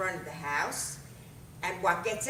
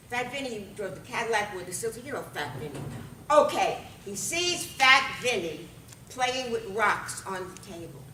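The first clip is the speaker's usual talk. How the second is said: ordinary delivery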